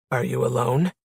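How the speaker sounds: low, level man's question; deep male voice. A narrator's reading. Armed man questioning the lost woman, deep voice. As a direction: drop well down to question her. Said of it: "deep, menacing".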